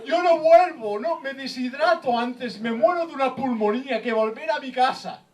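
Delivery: high, defiant hammed-up cry; mock lost husbands. Lost husband refuses to go home to his wife, high mock wail. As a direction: wail it out in mock desperation, stretching words. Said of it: "me imagino los tres tíos".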